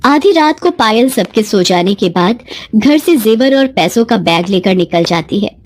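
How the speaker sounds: plain reading voice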